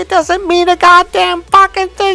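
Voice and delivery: high, loud mock-furious rant; furious yelling. Man losing it at his partner's questions, yelling furiously. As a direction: yell it at the peak of the row. Retold quarrel at its peak.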